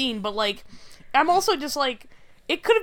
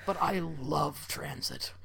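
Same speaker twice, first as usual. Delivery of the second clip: low, slow, soft declaration of love; hushed, deadpan devotion; solemn sincerity; 7 semitones lower, 9 dB quieter, slower than usual. Real, not acted. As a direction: drop down low and confess it slowly. Solemnly insists on loving transit.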